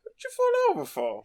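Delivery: very high, squeaky-sounding mock conductor; Stan voice. Host redoes Stan's question to Harry, very high. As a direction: pitch it way up like a comic Cockney lad. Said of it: "that sounds more like it".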